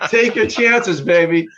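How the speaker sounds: a laughing warning to a friend about a dodgy plane; laughing advice. Retold or imitated.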